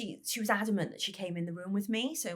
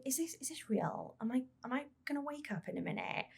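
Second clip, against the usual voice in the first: hushed, breathless disbelief in a whisper; a dazed inner whisper; stunned whisper; 5 semitones higher, 5 dB quieter than usual. Retold or imitated. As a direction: whisper it like the room has gone silent. Silent moment, stunned inner thoughts.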